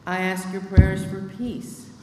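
usual speaking voice

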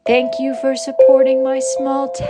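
tavern keeper. Acted. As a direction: greet guests like a jolly medieval tavern owner. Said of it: "that was a little bit of an ad lib there".

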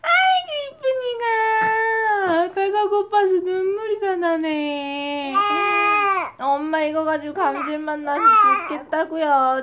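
high, laughing, half-crying exclamation; laughing sympathy. Real, not acted. Mother laughing as her hungry baby cries.

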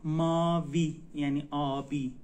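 normal talking voice

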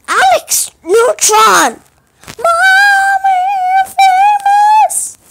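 high, slow shouted call; excited shout. Real, not acted. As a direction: call out to mom, thrilled. Calling out to mom.

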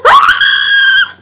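very high piercing scream; high-pitched scream of fright; terrified scream. Real, not acted. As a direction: shriek in fright. Scared by enemy.

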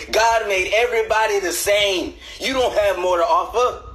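high-pitched whiny complaining about the cover; annoyed boyfriend. Acted. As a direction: nag your partner for stealing the blanket. Boyfriend voiced hogging the cover.

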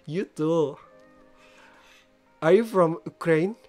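ordinary talk

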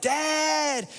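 high, loud drawn-out call; a kid calling from another room; child yelling for dad. Retold or imitated. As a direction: yell it like a kid across the house. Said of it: "they'll just be there and be like".

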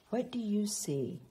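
plain reading voice

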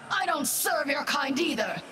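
high-pitched, emphatic comeback; high, falling punchline cry; exclaimed retort. Acted. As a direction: throw the line back, pitched well up. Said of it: "Then I say".

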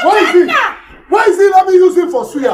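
high-pitched angry shouting; furious threat. Acted. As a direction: storm in looking for a fight. Enraged son threatening the creditor.

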